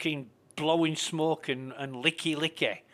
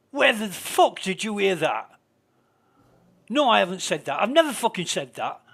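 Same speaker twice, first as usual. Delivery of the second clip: louder, higher mock outburst; imitating angry streamer; 5 semitones higher, 7 dB louder than usual. Retelling how the streamer snapped at him, louder mock aggression. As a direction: play the streamer snapping back, wound up. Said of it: "he's really wound up and aggressive".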